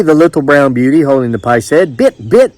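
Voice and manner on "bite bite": high "Bit, bit" with a short foreign vowel for bite; mispronounced "bite". A narrator's reading. Island woman insisting on her word, "Bit, bit" said for bite. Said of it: "That's what I say".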